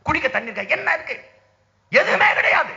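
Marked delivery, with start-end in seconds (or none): 1.9-2.7 high, loud angry verdict; rally orator shouting the bitter answer; furious shout. Real, not acted. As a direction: bark it out at the top of the voice. Shouted answer to own question.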